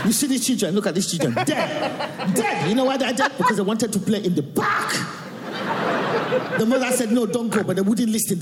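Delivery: imitating a Nigerian mother's warning; mother's Nigerian accent. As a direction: warn the kids in mum's Nigerian accent. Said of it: "And she'll be like that".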